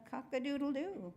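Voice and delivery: high cock-a-doodle-doo, moderately strong. A narrator's reading. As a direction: crow with growing confidence, still holding back. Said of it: "and then again louder".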